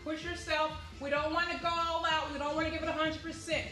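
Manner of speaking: ordinary talk, nothing marked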